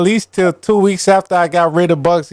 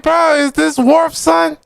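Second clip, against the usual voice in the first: incredulous; 12 semitones higher, slower than usual. Retold or imitated. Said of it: "I'm like".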